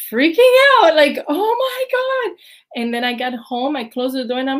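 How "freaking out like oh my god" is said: high-pitched, drawn-out starstruck "oh my God"; shocked, breathless gush at a ballet idol; starstruck squeal. Retold or imitated. Starstruck seeing Baryshnikov pass.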